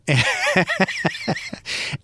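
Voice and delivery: high laugh at own joke; amused laugh. Real, not acted. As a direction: burst into a high chuckle.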